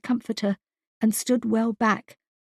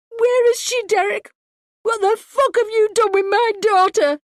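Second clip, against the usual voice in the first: much higher and louder, all out; furious scream; 12 semitones higher, 7 dB louder than usual. A narrator's reading. Wife screams at husband demanding her daughter, furious. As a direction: scream the accusation at him.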